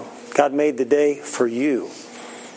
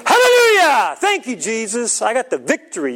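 a burst of a much higher, louder Hallelujah, then it settles; joyful shout; 10 semitones higher, 5 dB louder than usual. Retold or imitated. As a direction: shout the victory like you've already won. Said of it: "So I shout, I go".

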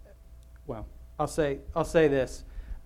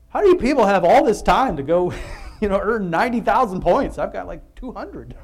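higher and louder, half laughing in disbelief; mock exasperated gripe; 7 semitones higher, 10 dB louder than usual. Pastor griping at other players' scores, loud and laughing. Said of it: "and I'm like".

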